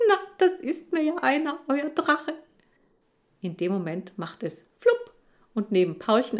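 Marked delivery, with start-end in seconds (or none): 0.1-2.3 high, amused lady's voice; chuckling older woman; amused librarian. Acted. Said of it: "sie lacht nur und meint".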